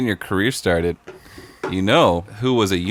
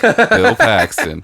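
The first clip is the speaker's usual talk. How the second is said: drawn-out, much higher and louder through a laugh; laughing reveal; 11 semitones higher, 6 dB louder, much slower than usual. Real, not acted. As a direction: stretch the name out while cracking up. Triumphant punchline reveal, laughing.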